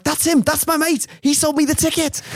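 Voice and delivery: very high, urgent mock shout; high-pitched impression of a frantic fan; Scouse fan calling out. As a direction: point someone out in a high yelp.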